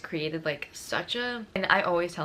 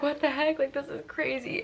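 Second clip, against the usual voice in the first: high-pitched incredulous reaction; retold amazement; 8 semitones higher than usual. Girlfriend learning friends knew, high amazement. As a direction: act out being astonished, pitched way up.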